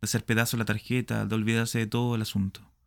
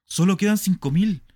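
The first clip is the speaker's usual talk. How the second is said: high, loud cry; loud dismay; 9 semitones higher, 7 dB louder than usual. Acted. Smith counts what is left, high loud cry. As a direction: shout the shortfall in panic. Discovers the missing money.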